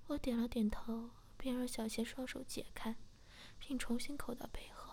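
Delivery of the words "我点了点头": ordinary delivery, nothing marked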